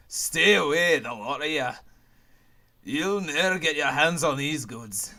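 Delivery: loud, slow gruff Scottish dwarf warning; Scottish dwarf accent. Acted. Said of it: "am I about to use a Scottish accent?".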